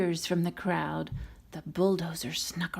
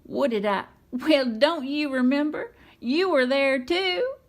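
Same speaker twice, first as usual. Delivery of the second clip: rising high and louder, slowing at the end; higher, louder Mater voice; Mater's reply; 8 semitones higher, 6 dB louder than usual. Acted. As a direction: act surprised he forgot, climbing higher. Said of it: "replied Mater".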